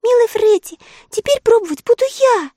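put-on very high, loud girlish plea; pleading little girl. A narrator's reading. Sister begging to taste the fish, high plea. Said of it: "взмолилась Элли".

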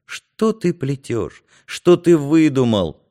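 desperate reproach, building in volume; desperate thought. A narrator's reading. Narrator silently challenges Юрка's story, with desperation. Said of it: "отчаянно думал я".

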